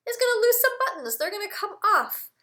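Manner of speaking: quick, high and excited. Shown as a demonstration. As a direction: build suspense for the kids. Teacher dramatizes buttons falling off.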